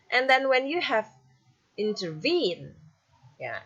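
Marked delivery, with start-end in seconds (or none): 1.8-2.7 "intervene" with IN-TER light and VENE stressed; final-syllable stress. Shown as a demonstration. Teacher showing an anapest example, final stress on "intervene". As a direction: lean on the last syllable of "intervene". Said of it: "stress, you have one".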